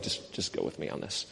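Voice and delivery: hushed conspiratorial whisper, breath only. Retold or imitated. Pastor whispering a plan to a volunteer after church, hushed whisper. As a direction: keep it secret, barely breathe it out.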